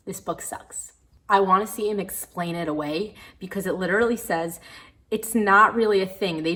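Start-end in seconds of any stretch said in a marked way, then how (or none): 0.1-0.9 "This book sucks" mouthed almost silently; whispered verdict. Real, not acted.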